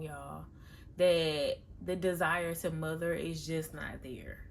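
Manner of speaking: ordinary talk, nothing marked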